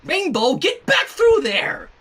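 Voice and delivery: high, slow scolding shout; high drawn-out angry command; scowling order. Acted. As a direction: yell at her like a fed-up big sister. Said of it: "Twilight scowled".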